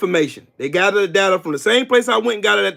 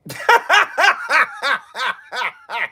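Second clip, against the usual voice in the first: long, higher cackle in even bursts; mocking laugh; 5 semitones higher than usual. Real, not acted. Streamer laughing at Tito, mocking cackle. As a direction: laugh at the loser. Taunting political opponent with laughter.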